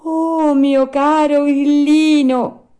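high, loud, drawn-out greeting; polite greeting. A narrator's reading. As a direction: greet the cricket with effusive courtesy. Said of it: "salutandolo garbatamente".

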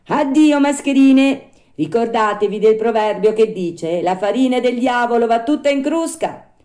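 high, loud puppet voice; scornful send-off. Acted. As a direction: dismiss the beggars with a proverb. Puppet again dismisses swindlers.

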